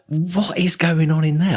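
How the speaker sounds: slow, high, baffled question; mock bewildered peering; puzzled wonder. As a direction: wonder aloud what on earth is inside. Peering into a mystery shop.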